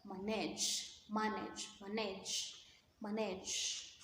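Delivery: manage repeated softly, stress on its second part; drilling the word. Shown as a demonstration. Teacher drilling word stress for students, softly repeated. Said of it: "stress the second part".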